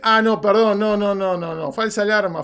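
ordinary talk

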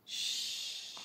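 very soft, long shh; whispered shush; hushing. A narrator's reading. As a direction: hush the listeners gently. Narrator hushes at story's end.

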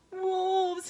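high-pitched storybook alarm; alarmed cry. A narrator's reading. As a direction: cry out as if spotting danger. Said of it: "he cried".

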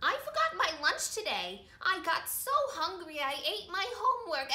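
ordinary delivery, nothing marked

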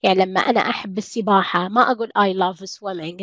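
usual speaking voice

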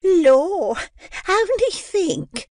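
high, slow gushing landlady voice; affected joy, very high and drawn out; gushing Mrs Mann. A narrator's reading. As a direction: overdo the delight, sugary and shrill-high.

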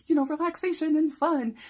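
high, loud whoa then bright chatter; hammed-up cheer; mock chipper. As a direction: play the refreshed vacationer. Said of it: "supposed to come back like".

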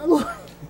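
bright high burst of laughter; sudden high chuckle. Real, not acted. Laughs at his own story.

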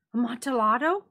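high, loud eager question; eager Fortunato. A narrator's reading. Drunk friend fixated on the wine, loud eager question. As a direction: pounce on the word. Fortunato repeats word, amazed.

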